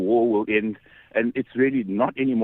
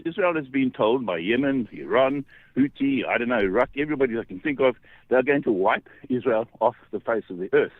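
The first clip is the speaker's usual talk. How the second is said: usual speaking voice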